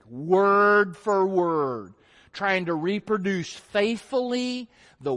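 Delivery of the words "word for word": Word for word spelled out very slowly, each word weighted; slow emphatic. Real, not acted. Preacher drives home the term to his congregation, very slowly and emphatically. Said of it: "exactly what you think".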